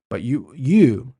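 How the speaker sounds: "you" stretched slow and punched loud, said twice; stress on YOU. Shown as a demonstration. Host singling out the project manager, slow heavy stress on "you". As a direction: lean hard on "you, you" to single out the listener. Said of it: "I wanna emphasize that word".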